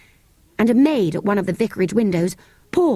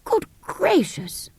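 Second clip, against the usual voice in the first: high, slow startled gasp of words; shocked old vicar; 12 semitones higher, slower than usual. Acted. Startled vicar hears yells.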